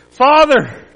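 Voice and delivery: loud call to the father; crying out. Acted. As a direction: call out as you give your life. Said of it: "Jesus cried".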